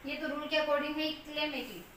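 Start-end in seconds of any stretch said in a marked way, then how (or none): none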